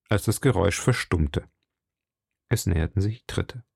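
ordinary delivery

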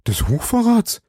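high, startled cry of alarm; frightened shopkeeper crying out. A narrator's reading. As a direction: gasp it out in shock. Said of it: "rief Bonacieux erschreckt".

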